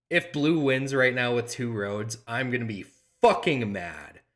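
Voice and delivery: ordinary talk, nothing marked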